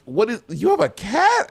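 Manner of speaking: high, surprised parent; imitating a shocked parent. Telling how her parents reacted to the cat, high surprise. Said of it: "they were like".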